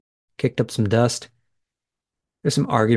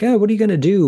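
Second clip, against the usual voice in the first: high, airy, dismissive shrug of a voice; brushing it off; 9 semitones higher, 3 dB louder, faster than usual. Retold or imitated. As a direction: toss it away like it's no big deal. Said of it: "kind of brushing it off like".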